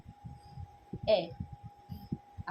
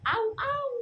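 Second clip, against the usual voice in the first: high barking; 11 semitones higher, 3 dB louder than usual. Shown as a demonstration. Mother shows dog sound.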